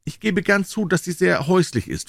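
high-voiced little swallow; conceding swallow. A narrator's reading. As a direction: grant the point in a high voice.

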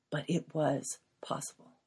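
soft unvoiced reassurance; hushed hopeful whisper. Real, not acted. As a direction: whisper the hope to the listener. Quiet hopeful confession, whispered.

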